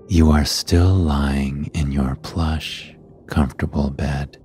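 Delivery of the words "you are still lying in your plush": ordinary delivery, nothing marked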